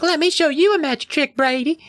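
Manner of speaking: put-on high, sing-song kid voice; bratty little sister. Susie taunts her brother Brady in a high cheeky voice. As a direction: tease him like a cheeky six-year-old. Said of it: "She's like".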